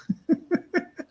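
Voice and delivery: high giggling laugh; high, amused chuckle; real laugh. Real, not acted. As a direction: giggle at your own silly joke. Laughing at own turd joke.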